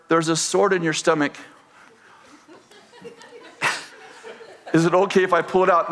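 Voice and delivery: mock-sheepish ask, laughing through it; sheepish, with a laugh breaking in. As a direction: ask it a little embarrassed, half laughing. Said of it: "So I kind of sheepishly say".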